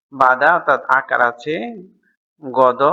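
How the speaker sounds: ordinary talk, nothing marked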